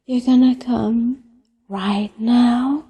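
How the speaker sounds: ordinary talk, nothing marked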